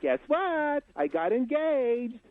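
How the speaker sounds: very high, mock-girlish announcement; drawn-out falsetto parody of a woman; engaged woman. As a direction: put on a high voice to share big news. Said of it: "A woman goes to her group of girlfriends and says".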